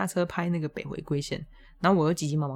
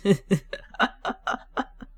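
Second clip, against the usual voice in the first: airy rising laugh; 2 semitones higher than usual. Real, not acted. Laughs about the third time.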